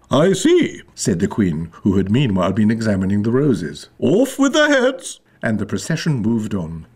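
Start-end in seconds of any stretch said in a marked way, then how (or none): 0.1-0.8 high royal interruption; high queen voice; imperious queen. A narrator's reading. Queen cuts in on gardeners. 4.0-5.2 high, drawn-out death sentence; shrill tyrant's order; imperious queen. A narrator's reading.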